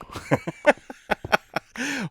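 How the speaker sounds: high burst of laughter. Real, not acted. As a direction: laugh at yourself. Laughs at own long tangent.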